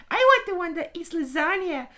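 put-on high innocent child; Ralph voice. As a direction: say it like a dopey little boy.

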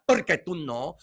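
ordinary talk

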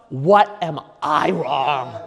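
slow, deliberate self-check; measured, effortful question to yourself; forced self-questioning. Acted. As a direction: spell it out slowly, making yourself ask it. Forcing himself to think.

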